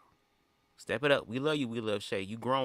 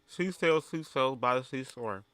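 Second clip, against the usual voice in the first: tongue twister through a mouthful of ice; lisping around ice cubes; mouth full of ice; 3 semitones higher than usual. Real, not acted. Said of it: "I sound like a bitch with a retainer in her mouth".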